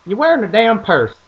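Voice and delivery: high, loud country dad voice; dad's voice. Retold or imitated. Imitating dad explaining about the purse, high and loud. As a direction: lay it on like a folksy dad. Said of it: "He's like".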